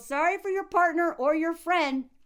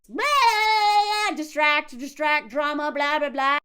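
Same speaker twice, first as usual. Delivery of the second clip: mocking blah; 2 semitones higher, 6 dB louder, slower than usual. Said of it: "mocking the narcissist's arguing".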